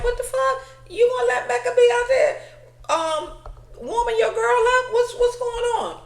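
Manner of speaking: hammed-up shocked friend, laughing through it; Adam's outrage. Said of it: "And Adam is like".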